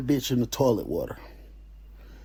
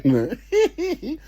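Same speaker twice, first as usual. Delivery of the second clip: high laugh breaking into the story; can't hold back a laugh; burst of laughter. Real, not acted. Laughing while retelling.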